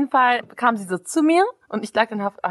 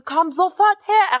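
slow, raised uncle's command; angry uncle shout; 7 semitones higher, slower than usual. Retold or imitated. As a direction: bark it slowly like an uncle losing it. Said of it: "Er rastet richtig aus".

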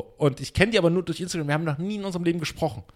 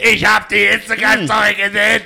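high, loud, hyped-up exclamation; overexcited outburst; 8 semitones higher, 10 dB louder than usual. Retold or imitated. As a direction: blurt it out all worked up. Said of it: "er fährt sich nicht mehr so hoch".

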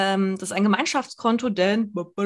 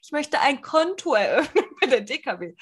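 mock-meek request in a higher voice; kleinlaut; 5 semitones higher than usual. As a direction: imitate your timid self asking at the bank. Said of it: "weil ich so kleinlaut war". (kleinlaut nachgeahmt, etwas höher)

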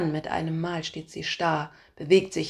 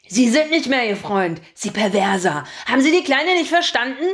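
put-on loud, higher, indignant old-man rant; furious old man; 6 semitones higher, 8 dB louder than usual. A narrator's reading. Old Alfred loudly berates the narrator as a pervert. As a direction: scold him like a righteous pensioner.

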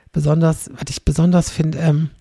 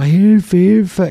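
higher, louder cries of Hilfe; mock cry for help; 4 semitones higher, 9 dB louder than usual. Son imitating a drowning man calling out, mock shouting. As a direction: call out like a drowning man, hammed up.

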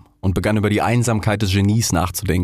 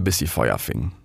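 ordinary delivery

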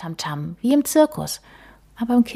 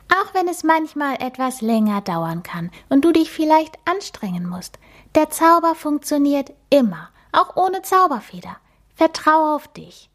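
much higher, tiny and sweet mouse voice; a cute magic mouse introducing herself; little mouse voice; 9 semitones higher than usual. Acted. The magic mouse introduces herself to Emma, high and squeaky.

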